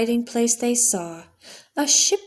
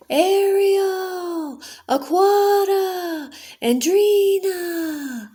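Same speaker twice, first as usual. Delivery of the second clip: higher, slow drawn-out calls from far off; distant calling; 10 semitones higher, much slower than usual. Acted. A royal guard searching for the lost sisters, calling from afar. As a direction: call their names across open water. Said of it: "she heard someone in the distance".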